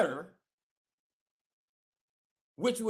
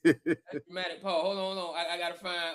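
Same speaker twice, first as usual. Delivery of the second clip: laughing; 5 semitones lower, 4 dB quieter than usual. Real, not acted. Laughs at cohost's joke.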